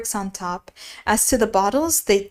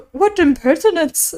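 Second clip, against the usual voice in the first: indignant; 7 semitones higher, 6 dB louder than usual. A narrator's reading. The pudding outraged.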